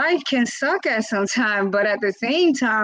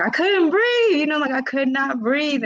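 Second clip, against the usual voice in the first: upset; 8 semitones higher than usual. Retold or imitated. Telling her husband she couldn't breathe, tearful and high. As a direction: voice breaking as she relives it. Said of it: "and I'm just like".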